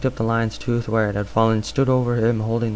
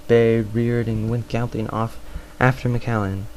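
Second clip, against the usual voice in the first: ordinary delivery, nothing marked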